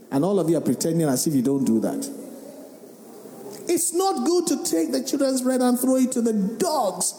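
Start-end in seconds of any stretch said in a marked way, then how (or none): 3.7-7.1 higher, pointed rebuke; sharp rebuke. Acted. The Lord testing the woman, higher pointed rebuke. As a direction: throw the insult at her, pitched up.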